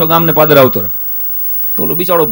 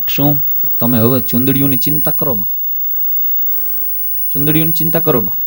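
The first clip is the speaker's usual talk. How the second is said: softer, gentle wife's line; soft meek reassurance; 7 dB quieter than usual. Shown as a demonstration. New bride soothing her husband, softly and meekly. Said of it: "ચોથા નંબરના બોલતા જ હોય ને".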